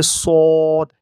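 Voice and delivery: "সদ" loud, rounded and long, unlike a thin s; correct letter sound. Shown as a demonstration. Teacher corrects the common slip, loud drawn-out "সদ". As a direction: round out "সদ" and hold it. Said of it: "আমরা সিন উচ্চারণ করে ফেলি".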